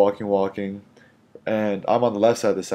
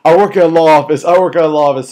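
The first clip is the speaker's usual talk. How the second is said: loud, high mock yelling; retold indignant shout; 8 semitones higher, 12 dB louder than usual. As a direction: imitate an outraged woman shouting it repeatedly. Retelling the woman's angry outburst.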